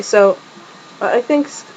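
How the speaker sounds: usual speaking voice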